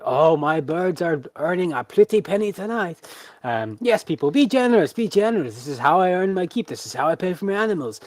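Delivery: high, loud, pleading ringmaster pitch; rally the crowd to pay up; eager showman. Acted. Tamer urging the crowd to give generously, loud eager pitch.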